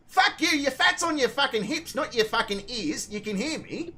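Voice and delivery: high, heated comeback easing off at the end; retold yelling. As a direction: yell back in a mock-furious retelling. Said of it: "as I'm yelling at her".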